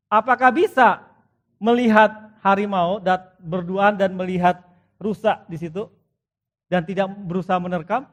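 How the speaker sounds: loud, high-pitched rhetorical questions to the congregation; booming sermon challenge; preaching at full force. Acted. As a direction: press the flock with loud questions.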